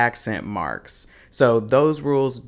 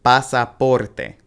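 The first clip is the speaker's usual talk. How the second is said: slow Pasaporte as pa-sa-POR-te; stress on third syllable; 4 dB louder, slower than usual. Shown as a demonstration. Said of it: "you'll stress the third one".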